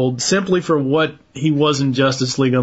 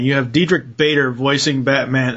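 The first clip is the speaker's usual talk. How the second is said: ordinary talk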